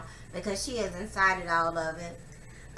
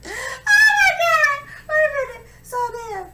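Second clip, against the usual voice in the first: high shrieking cry of alarm; sudden loud crying shriek, slow; real startled wail; startled outcry; 12 dB louder, slower than usual. Real, not acted. Sudden real outburst mid story.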